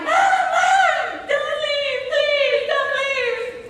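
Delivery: put-on high pleading child's plea; imitating crying child. Son crying as mom leaves for work, high pleading. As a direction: mimic a six-year-old begging mom not to leave. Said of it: "he starts crying".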